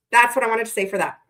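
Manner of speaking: ordinary talk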